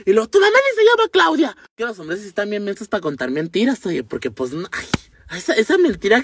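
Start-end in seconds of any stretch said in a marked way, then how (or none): 0.3-1.6 high, loud mocking jab at the lie; sarcastic comeback to a cheating boyfriend; scornful retort. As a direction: scoff at the lie, pitched way up.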